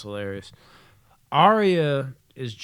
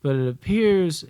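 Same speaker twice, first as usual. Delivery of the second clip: raised, louder mock-serious announcement; grave brother; 3 semitones higher, 6 dB louder than usual. Brother reporting bathroom discovery, mock-grave. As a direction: report it with halting, careful formality.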